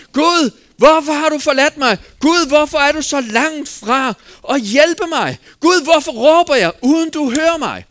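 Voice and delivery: loud, high plea to God; anguished cry. Acted. David in his forsakenness calls to God, anguished cry. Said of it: "som David råbte efter".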